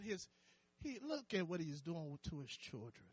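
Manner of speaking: low, hushed mocking asides; sarcastic gossip. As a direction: sneer quietly behind his back. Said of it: "Sarcasm, cracking jokes, talking behind his back".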